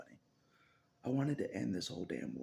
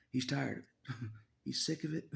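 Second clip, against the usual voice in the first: laughing while talking; 6 semitones higher, 4 dB louder than usual. Real, not acted. Laughing about God's frustration.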